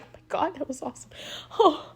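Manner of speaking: very soft, high, breathless awe; mock out of breath. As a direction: gasp it as if too enthralled to breathe. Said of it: "And just out of breath".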